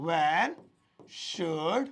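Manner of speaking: walk through each word slowly; slow dictation. Shown as a demonstration. Teacher spelling out the sentence word by word for learners. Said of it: "ऐसे बना सकता हूं".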